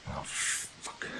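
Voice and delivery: whispered curse. Acted.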